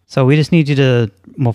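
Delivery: ordinary talk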